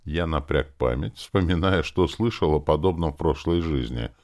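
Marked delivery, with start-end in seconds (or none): none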